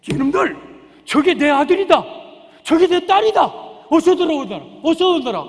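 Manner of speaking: loud, high glad declaration; joyful claim. Acted. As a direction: welcome them home with joy. Jesus welcoming his own. (높고 크게 기뻐하며 맞아들이듯)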